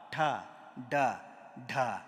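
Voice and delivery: ordinary delivery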